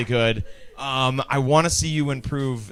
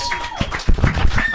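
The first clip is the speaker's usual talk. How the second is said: squeaky high. Real, not acted. Host squeals first.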